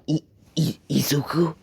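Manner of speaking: mild hushed shock, catching on the first sound; shocked stammer. Acted. Stunned heroes recognize Deku walking past, shocked stammer. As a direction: stammer softly in disbelief. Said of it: "All of them are in shock".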